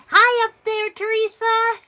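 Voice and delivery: high, loud playful holler; calling up. Pretend. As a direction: call out to someone up in the balcony. Greets viewer in the balcony.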